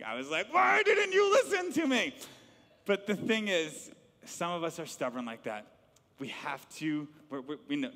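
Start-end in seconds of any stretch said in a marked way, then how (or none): 0.5-2.2 much higher, loud exasperated shout; angry dad shouting at his son after the crash; angry yell. Retold or imitated. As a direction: yell at him in frustration. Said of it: "I was angry".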